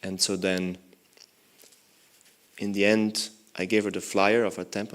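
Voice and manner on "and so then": normal talking voice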